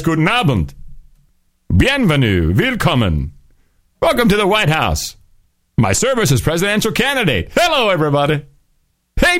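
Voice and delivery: high, hammed-up rally greeting; mock president greeting. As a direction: ham up a politician waving at the crowd.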